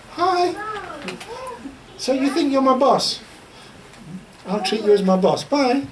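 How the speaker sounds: mock-sweet curt hello and goodbye to the boss; cold dismissive greeting. Telling the congregation how he'd greet her, mildly mocking. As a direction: brush her off with a lightly voiced fake politeness. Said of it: "The next time I see her".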